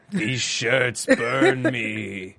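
slow, stretched-out mock impression; Dyson. Host hamming up a slow Dyson impression about burning shirts. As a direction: draw every word out in a hammed-up imitation. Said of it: "it's a Dyson impression".

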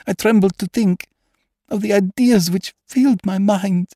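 high, strained voice of a haunted lady; frightened noblewoman. Acted. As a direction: tell it as a woman on the edge of madness. Possessed woman recounts her dream.

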